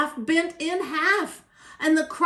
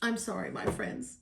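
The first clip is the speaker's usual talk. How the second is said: low, soft, sorrowful elephant; hushed and regretful; sad apology; 7 semitones lower, 8 dB quieter than usual. A narrator's reading. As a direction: apologize sadly, dropping low and quiet. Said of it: "said the elephant sadly".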